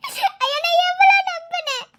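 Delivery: mother keening in shame and grief; slow weeping lament of a betrayed mother; wailing mother. Acted. Mother wails over runaway daughter.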